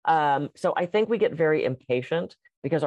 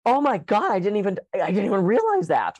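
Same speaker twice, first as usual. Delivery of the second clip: surprised; 6 semitones higher, 4 dB louder than usual. Retold or imitated. Client realizing her progress.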